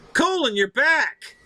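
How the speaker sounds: high, drawn-out welcome; happy to see him return; glad greeting. Real, not acted. Welcoming Colin back to the chat, glad.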